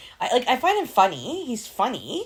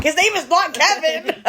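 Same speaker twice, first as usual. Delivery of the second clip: higher, louder, slow laughing protest; laughing correction; 9 semitones higher, 6 dB louder than usual. Retold or imitated. Mother correcting her daughter about the actor, laughing. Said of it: "I'm like".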